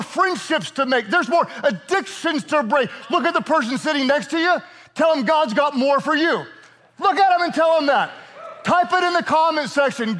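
high, shouted exhortation; preaching at full force. Acted. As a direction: urge them to tell their neighbor.

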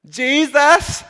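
loud, high sing-song calling of Jesus; mother calling out. Retold or imitated. Mother loudly calling Jesus to ask a favor, high and bright. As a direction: belt out his name like an eager mom. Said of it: "And she's like".

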